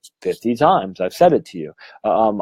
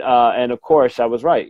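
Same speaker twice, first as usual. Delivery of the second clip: ordinary delivery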